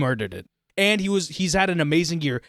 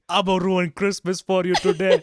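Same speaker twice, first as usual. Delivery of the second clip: Arnold impression with an Indian accent; hammed-up Arnold villain, Indian accent; Indian Arnold Schwarzenegger; 3 semitones higher than usual. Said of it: "comment on your impersonation".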